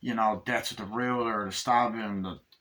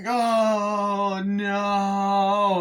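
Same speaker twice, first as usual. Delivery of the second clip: high, loud wailing doll voice; mock dismay; 10 semitones higher, 6 dB louder than usual. Chucky sprayed with hot plastic, high wail. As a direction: wail as you melt. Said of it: "spray his hot plastic".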